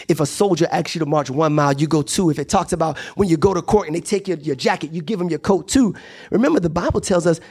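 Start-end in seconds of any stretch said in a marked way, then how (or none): none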